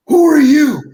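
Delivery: loud, sharp challenge, imitated; demanding retort. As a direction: demand it loudly, as in a retold confrontation. Girl demands to know who he is.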